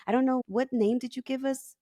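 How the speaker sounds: ordinary talk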